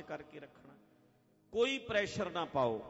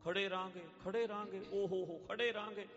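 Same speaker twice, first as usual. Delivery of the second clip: higher-pitched, repetitive fretting; fussy, high mock complaining; mock irritation; 4 semitones higher than usual. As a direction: imitate someone whining about delays. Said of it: "ਹੁਣ irritate ਹੋਈ ਜਾ".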